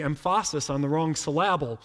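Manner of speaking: "emphasis" and "syllable" deliberately stressed on the second syllable; joking mispronunciation. Retold or imitated. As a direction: imitate dad's joke, saying em-FAH-sis for "emphasis" and sil-LAB-le for "syllable". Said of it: "he would mispronounce something".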